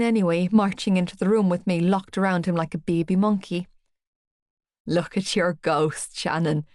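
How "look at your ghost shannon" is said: chuckling. Acted. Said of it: "he chuckled".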